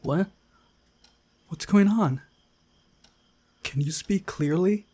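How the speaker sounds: put-on high, startled girl just woken; confused sleepy girl.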